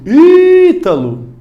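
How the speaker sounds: "Ítalo" called loud and high, first Í held very long; stretched-out shout. Shown as a demonstration. Teacher calls to a friend as a demo, long shouted Í in "Ítalo". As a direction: holler the name, dragging out the stressed Í. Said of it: "vai estender o grito".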